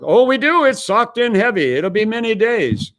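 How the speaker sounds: high-pitched, insistent imitated warning; emphatic radio operator. Operator warning the captain about ice fog, emphatic and high.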